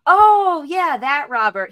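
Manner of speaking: loud, high "oh" easing down slowly; mock sudden realisation, drawn out; exaggerated recognition. Gate agent claims to remember Robert, exaggerated recognition.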